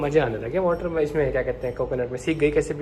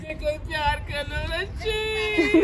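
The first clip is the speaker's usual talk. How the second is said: higher, softer hammed-up sob-whine; mock crying; 9 semitones higher, 4 dB quieter, slower than usual. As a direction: fake tears to win the toddler. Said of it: "नकली acting ना करो आप".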